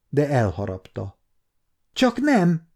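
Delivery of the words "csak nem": much higher, stunned cry; a sudden high exclamation of shock; surprised cry. A narrator's reading. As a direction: gasp it out in disbelief. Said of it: "kiáltott fel újra".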